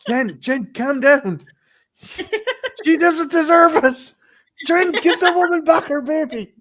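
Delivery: high, loud mock alarm; mock pleading. Host pleading with co-host in a shop, high loud mock alarm. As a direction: plead with her to give the baby back.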